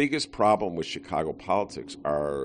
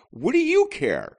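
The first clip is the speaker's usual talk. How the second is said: high, loud exasperation; exasperated; 7 dB louder than usual. Real, not acted. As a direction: throw up your hands. Exasperated rant at voters.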